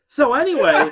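words breaking into a laugh; can't hold back the giggle; high, loud, laughing through it. Real, not acted. Reader laughing mid-line.

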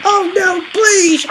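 very high-pitched plea; high, groaned protest; groaning little engine. A narrator's reading. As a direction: plead in dismay as a tiny engine. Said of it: "groaned Stanley".

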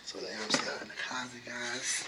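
whispering from the closet; hushed whisper while hiding in the closet. Real, not acted. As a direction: hide and whisper so she won't hear. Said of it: "I don't know why I'm talking like this".

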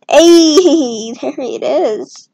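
ordinary talk, nothing marked

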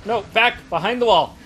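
loud, high retreat order; urgent calling. Real, not acted. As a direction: call out urgently to get to cover. Urgent retreat during combat.

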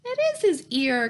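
ordinary delivery

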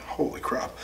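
awed whisper under the breath. Real, not acted. Amazed at the tiny transmitter.

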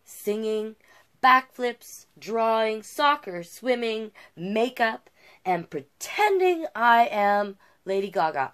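bright, lively read-aloud listing hobbies; picture book narration. A narrator's reading. Reader shares Jazz's hobbies, lively storybook narration.

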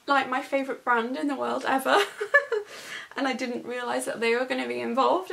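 normal talking voice

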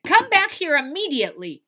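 high, loud bossy order; Eugenia shouting. A narrator's reading. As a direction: shout a command at a sister. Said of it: "shouted Eugenia".

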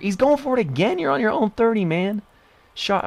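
can't believe the fourth-down gamble; exasperated shock at the call; incredulous. Real, not acted. Opponent goes for it again.

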